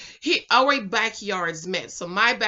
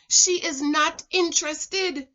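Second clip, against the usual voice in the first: put-on higher, careful, drawn-out Jamaican-accented refusal; trying to speak proper; 7 semitones higher than usual. Brother turning down her admirer, slow and proper with Jamaican accent. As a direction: spell it out in careful Jamaican English. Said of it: "he was trying to speak proper".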